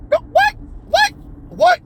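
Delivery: very high, repeated squawks of disbelief; incredulous squawk. Acted. Reacting to Ellie's gonna be a dad, high incredulous squawking. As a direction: squawk each word as if appalled.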